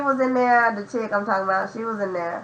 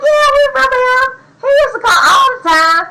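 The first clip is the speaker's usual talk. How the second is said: lightly voiced old ladies, higher and louder, quick; old ladies' voice; 13 semitones higher, 5 dB louder than usual. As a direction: chime in like excited older coworkers. Old ladies in the break room.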